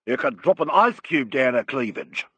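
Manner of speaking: a touch of a brag; mildly boastful character voice. A narrator's reading. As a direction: boast it like a proud manager. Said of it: "boasted her manager".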